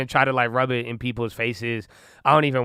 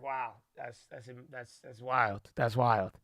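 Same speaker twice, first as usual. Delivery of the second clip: much softer, trailing, dumbfounded; stunned hush; 2 semitones lower, 10 dB quieter, slower than usual. Retold or imitated. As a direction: let the disbelief drain the voice. Stunned reaction, retold.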